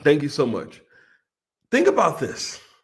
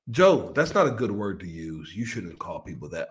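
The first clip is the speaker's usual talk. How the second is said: low, soft scolding inner voice; tut-tut at himself, hushed and low; mock scolding conscience; 10 semitones lower, 5 dB quieter than usual.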